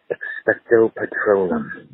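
slow, careful "Expecto Patronum"; slow demonstration. Shown as a demonstration. Lupin demonstrates the incantation to Harry, slow. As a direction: say "Expecto Patronum" slowly like a teacher showing it. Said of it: "The incantation is this".

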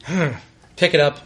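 normal talking voice

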